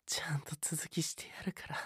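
soft unvoiced whisper. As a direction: breathe it quietly. Whispered aside to partner.

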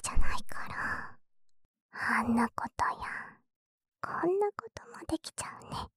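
hushed teasing whisper; suggestive whisper. Acted. Sister whispers suggestive teasing to her flustered brother. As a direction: whisper the hint slyly.

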